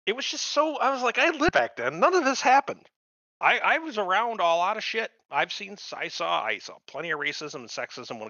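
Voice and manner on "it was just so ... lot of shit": worked up. Real, not acted. Ranting about the nineties portrayal, a worked-up high voice. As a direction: push the pitch right up in disbelief.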